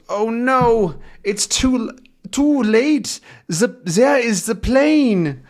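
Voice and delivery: high, drawn-out cry of dismay; alarmed. Acted. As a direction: cry out high, stretching the words. Acting out dismay at plane.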